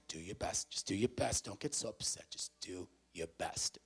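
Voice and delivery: put-on soft, hushed reassurance to a child; soothing parent. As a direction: comfort a small child very quietly.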